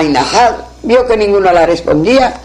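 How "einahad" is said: plain reading voice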